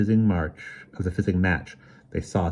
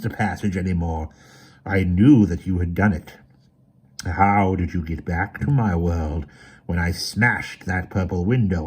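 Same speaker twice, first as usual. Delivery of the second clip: villain voice; 5 dB louder than usual. A narrator's reading. Autarch villain speaks.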